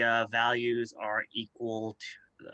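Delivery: normal talking voice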